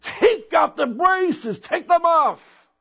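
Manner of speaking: high, drawn-out scream, imitating the healed man; retold scream. Healed man screams from the wheelchair, imitated high-pitched scream. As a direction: cry it out high and slow, as if screaming. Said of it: "and he screamed".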